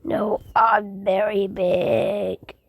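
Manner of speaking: low, slow and soft; deep slow boast. Acted. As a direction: drop it low like a giant. Boasting about size.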